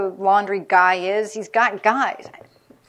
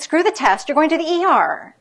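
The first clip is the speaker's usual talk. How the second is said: loud, high, bossy order; retold shout; 6 semitones higher, 4 dB louder than usual. Dean ordering the sick med student to the ER, loud and high. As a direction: bark it like a dean who won't take no.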